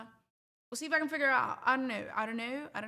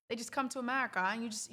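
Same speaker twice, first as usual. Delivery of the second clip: usual speaking voice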